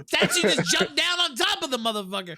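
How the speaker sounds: high, loud laughter through the words; laughing. Real, not acted. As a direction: crack up while you say it. Laughing at co-host's joke.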